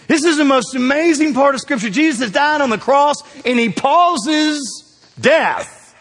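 high, loud building proclamation; preaching shout. Acted. Pastor rising to a shout about Jesus' pause.

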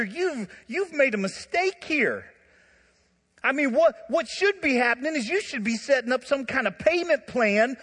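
loud, high, righteous complaint about the brother; indignant accusation. Acted. Older brother accusing his brother to the father, loud and outraged. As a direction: build the case and pile on the outrage.

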